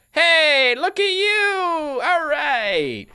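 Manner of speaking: high, drawn-out delighted hello; slow, soaring look at you; excited greeting. Real, not acted. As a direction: greet the newcomer with big delight. Greeting new colonist.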